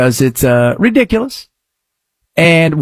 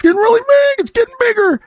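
mock squeak; faster than usual.

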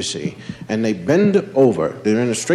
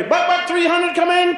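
much higher game call; kid calling out. Retold or imitated. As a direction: call in number three hundred.

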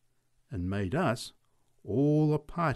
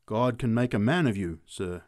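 usual speaking voice

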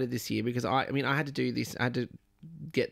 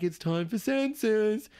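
higher, drawn-out doorstep announcement; census collector's call; 7 semitones higher, slower than usual. Retold or imitated. Census worker announcing at someone's door, drawn-out call. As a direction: sing out a mock official greeting, slowly.

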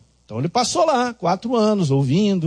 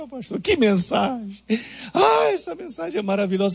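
tearful praise; 6 semitones higher than usual. Retold or imitated. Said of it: "E ela chorando".

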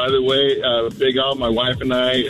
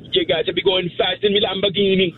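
high-pitched put-on Jamaican; Jamaican accent; 7 semitones higher than usual. Shown as a demonstration. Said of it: "No, a Jamaican accent".

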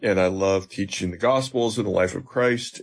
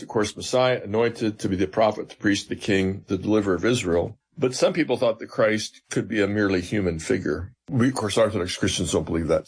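usual speaking voice

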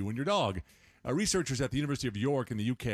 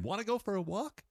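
higher sing-song question to a dog; baby talk to a dog; 8 semitones higher than usual. Shown as a demonstration. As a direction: ask it like you're exciting a puppy. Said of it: "not my imitation".

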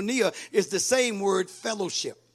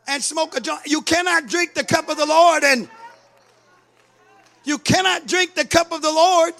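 loud, high, driving sermon; full-force preaching; 5 semitones higher, 4 dB louder than usual. Real, not acted. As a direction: hammer it home to the pews.

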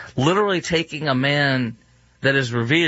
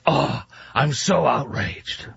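slow, sarcastic fake outrage; mock a self-righteous scold; drawn-out sneering outrage; 2 semitones higher, slower than usual. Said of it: "holier than thou or like".

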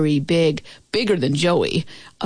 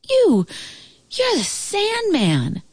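much higher, awed, slowing down; amazed realisation; 12 semitones higher, slower than usual. A narrator's reading. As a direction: gasp out who he is. Boy recognises the Sandman.

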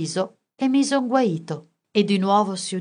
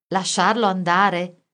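ordinary delivery, nothing marked

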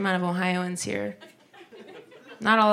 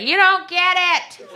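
loud, higher, slow and scolding; mock scolding lady, louder and higher; annoyed lady; 10 semitones higher, 4 dB louder than usual. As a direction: lecture him like an indignant churchgoer. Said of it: "she was like".

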